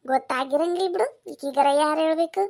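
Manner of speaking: ordinary talk, nothing marked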